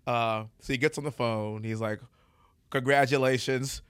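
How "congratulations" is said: slow presidential impression, Trump accent slipping toward Clinton. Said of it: "A Bill Clinton Trump accent".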